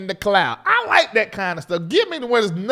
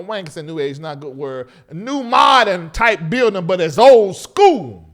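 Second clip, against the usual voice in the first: normal talking voice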